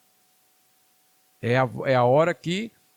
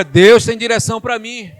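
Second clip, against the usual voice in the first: loud, raised preaching call; rallying declaration; 6 semitones higher, 10 dB louder than usual. Shown as a demonstration. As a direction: lead the congregation in a bold shout. Has congregation repeat a declaration.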